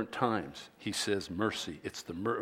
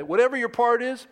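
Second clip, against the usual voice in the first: high, loud WHATEVER; ringing title line; proclaimed title; 9 semitones higher, 5 dB louder than usual. Shown as a demonstration. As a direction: announce the sermon title. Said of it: "sermon title today".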